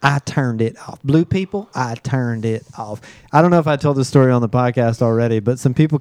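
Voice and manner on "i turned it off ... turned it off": drawn-out Southern catchphrase, imitated; Bethany. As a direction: imitate her slowly, softening at the end. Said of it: "That's my Bethany".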